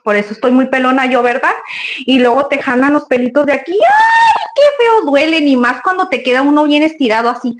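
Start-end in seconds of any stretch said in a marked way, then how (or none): 3.7-5.5 high shrieking ouch as if hair is yanked; mock pain cry. Complaining to her audience about hair pulling, shrieked mock pain. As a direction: yelp of hammed-up pain, much higher.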